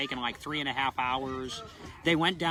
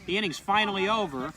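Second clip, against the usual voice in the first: play-by-play; 6 semitones higher, 5 dB louder than usual. Acted. Live play-by-play of inning's end.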